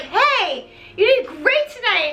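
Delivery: high-pitched, mock-sweet congratulations; Raoul. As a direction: greet her in a put-on high voice. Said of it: "He's just like".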